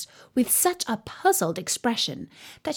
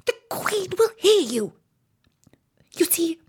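high, breathy, anxious warning; frightened whisper; 9 semitones higher than usual. A narrator's reading. Rabbit fears the Queen will hear, frightened whisper. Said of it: "whispered in a frightened tone".

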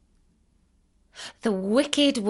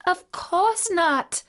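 put-on high, slow girlish voice; high-voiced child protesting; little girl's answer; 10 semitones higher, slower than usual. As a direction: answer as a young girl, pitched way up. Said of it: "answered Dorothy".

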